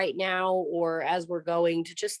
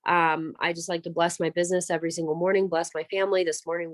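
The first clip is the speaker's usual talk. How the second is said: ordinary talk, nothing marked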